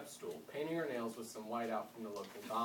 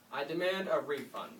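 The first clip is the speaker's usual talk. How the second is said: loud, higher demand; indignant shout; 4 semitones higher, 6 dB louder than usual. Acted. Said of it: "Mister Crane shouted".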